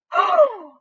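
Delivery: loud high dramatic gasp; hammed-up gasp of shock. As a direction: gasp in horror.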